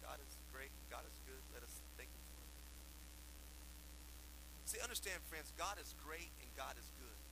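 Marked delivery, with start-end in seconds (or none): 0.0-2.5 put-on recited child's prayer. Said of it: "Remember that little prayer".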